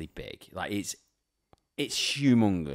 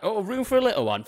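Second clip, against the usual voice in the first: mock cheeky bloke; 13 semitones higher, 6 dB louder than usual. Imitating an annoying man at the urinal.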